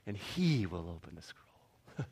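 hushed, breathy reveal of a secret; dramatic whisper. Acted.

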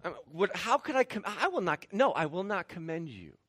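exasperated, stammering false starts; acted flustered indignation; beside himself. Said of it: "Paul's beside himself".

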